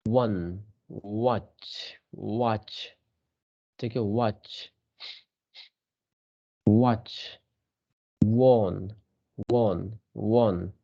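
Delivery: compare "वन" with "वॉच", same w sound; comparing sounds. Shown as a demonstration. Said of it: "इसको compare करो".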